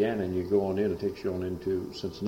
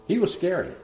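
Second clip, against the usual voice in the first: normal talking voice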